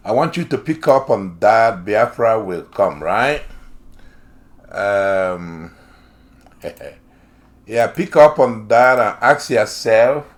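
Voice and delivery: ordinary talk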